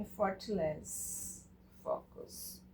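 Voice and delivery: slow, relaxed, whispered "Effortless focus"; soft relaxed whisper. Shown as a demonstration. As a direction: breathe out "Effortless focus" gently. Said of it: "look effortless a point and then relax".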